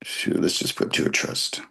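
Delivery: low, barely voiced mutter to himself; muttered aside. Real, not acted. Teacher fumbling with his phone mutters to himself. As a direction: mumble under your breath while fumbling the phone.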